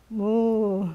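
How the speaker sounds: long, drawn-out moo; cow moo. Shown as a demonstration. Yoga teacher demonstrating the cow sound, drawn-out moo. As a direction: imitate a cow's moo. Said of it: "What's the sound we make for".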